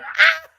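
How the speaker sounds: high, loud squealing laugh; childish laughing squeal. Retold or imitated. As a little girl in another room, squealing with laughter. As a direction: let out a child's delighted shriek. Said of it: "You'd hear me going".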